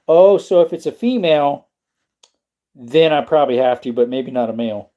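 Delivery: ordinary delivery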